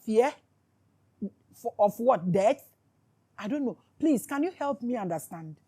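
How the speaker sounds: normal talking voice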